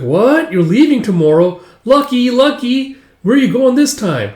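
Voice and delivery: put-on high, loud, envious kid voice; excited child. Child learning of the trader's voyage, excited. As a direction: gush with eager envy.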